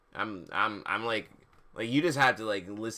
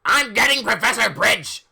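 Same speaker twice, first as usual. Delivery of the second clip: high, loud storming-off threat; hammed-up furious shout; angry yell; 10 semitones higher, 11 dB louder than usual. A narrator's reading. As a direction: screech it in a huff. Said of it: "He stomped out angrily".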